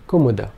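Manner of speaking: kumuda quick, every vowel clipped; all vowels short. Shown as a demonstration. Teacher showing all short vowels in kumuda. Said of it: "all three vowel sounds being short".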